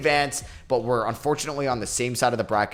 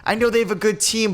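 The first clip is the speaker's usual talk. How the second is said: exasperated outburst; 10 semitones higher, 3 dB louder than usual. Real, not acted. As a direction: sound fed up with this rival. Frustrated at losing to Jazz.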